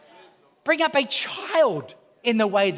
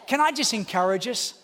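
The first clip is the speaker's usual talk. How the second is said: normal talking voice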